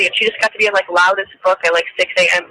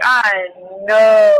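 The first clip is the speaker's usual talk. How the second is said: slow, drawn-out shouted disbelief. Retold or imitated. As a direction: shout it out slowly, outraged. Retold outraged reaction.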